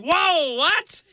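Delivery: Daniel voiced in mock surprise; mock astonishment. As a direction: act Daniel's surprise, jokingly high.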